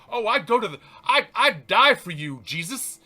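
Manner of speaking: high, loud, earnest vow; Peter pledging loudly. Retold or imitated. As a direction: swear it with all your heart. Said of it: "Peter says, goes".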